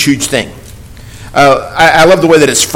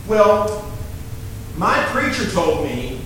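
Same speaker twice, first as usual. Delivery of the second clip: normal talking voice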